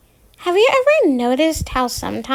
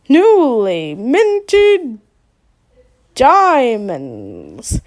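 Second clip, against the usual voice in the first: slow, drawn-out game show announcer; hammy radio host; 5 dB louder, much slower than usual. Acted. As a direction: stretch it out like a prize reveal. Fake radio host prize reveal.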